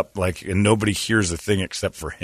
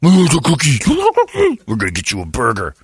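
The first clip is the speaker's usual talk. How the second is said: higher, louder pet talk; mock dog praise; 6 semitones higher, 4 dB louder than usual. Officer rewarding the police dog, mock sing-song. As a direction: coo at the dog like a handler.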